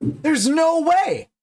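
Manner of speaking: incredulous shout. Real, not acted. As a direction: blurt it out in total disbelief. Disbelief at guest's voice.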